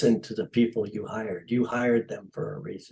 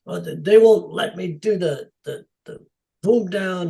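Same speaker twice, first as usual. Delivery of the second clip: mildly imitated upset complaint; higher, halting protest, lightly imitated; 6 semitones higher, 3 dB louder than usual. Director complaining that crew won't shoot, mildly upset. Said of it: "he was all upset".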